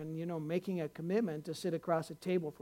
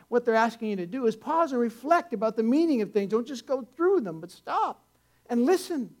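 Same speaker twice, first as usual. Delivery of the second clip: louder, higher preacher's push; rising preaching; 5 semitones higher, 7 dB louder than usual. Acted. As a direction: drive the point home to the congregation. Preacher builds to exhortation.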